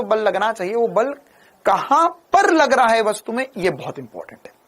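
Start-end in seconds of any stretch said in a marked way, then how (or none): 3.6-4.5 whispered aside, much softer. Real, not acted. Teacher whispers to the class that this point matters. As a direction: let them in on a secret.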